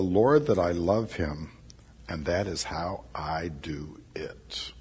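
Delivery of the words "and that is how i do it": whisper. Real, not acted. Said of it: "hushed personal confession".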